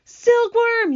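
very high, loud call; urgent yell. Acted. James summons the silkworm to save his friend, urgent yell. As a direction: yell the name across the deck. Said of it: "yelled James".

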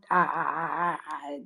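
voice breaking. Real, not acted. Choking up about her reputation.

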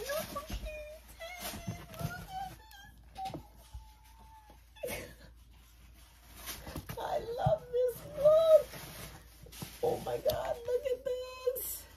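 high, breaking cry of joy; real happy tears in a high voice; teary squeal. Real, not acted. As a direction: gasp and squeal through tears. Overwhelmed by the gift.

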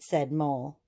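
plain reading voice

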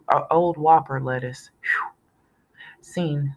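usual speaking voice

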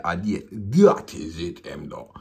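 South African brethren giving his approval in a hammed-up accent; imitating a South African friend. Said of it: "He said".

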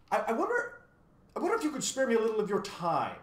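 high, loud, hesitant polite request; courteous, lightly tentative inquiry. A narrator's reading. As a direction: ask a favour delicately, starting with a stammer. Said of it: "he inquired politely".

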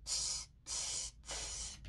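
unvoiced tss, tss hissing; steam hiss. Shown as a demonstration. Showing viewers the German train hiss. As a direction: hiss like a steam engine letting off steam. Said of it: "It goes".

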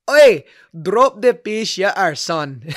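loud, drawn-out mock Scottish accent. Drunk Scottish woman ordering him in the bar, hammed-up Scottish accent. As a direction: call it out slowly in a broad brogue. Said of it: "my best Scottish accent".

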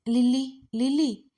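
mild call through a door. A narrator's reading. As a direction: call a name to rouse someone. Father calling through the door.

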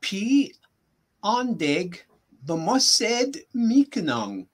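slowly, with a pause after "Pi" and after "andeg"; slow demonstration. Shown as a demonstration. Teacher repeats the sentence for a learner, slowly. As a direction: say "Pi andeg b'mased mikanang" slowly, in separated chunks. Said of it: "say that one more time slowly".

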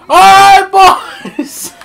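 put-on high, loud call to the boys; mock officer shouting. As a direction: yell over the drums like an officer. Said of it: "They're just like".

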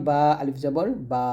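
final "বা" held with a long aa; drawn-out vowel. Shown as a demonstration. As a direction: stretch out the last "বা". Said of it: "এক আলিফ টেনে পড়বো".

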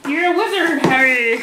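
hammed-up famous film line; Hagrid. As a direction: put on a playful wizard-film character voice. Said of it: "my favorite Hagrid line".

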